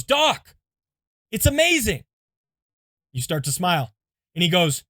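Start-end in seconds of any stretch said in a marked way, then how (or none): 0.0-2.0 wide-eyed mock amazement; amazed. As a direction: gasp it out like a small miracle. Patient amazed at his bleeding.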